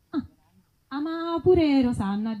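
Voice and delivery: normal talking voice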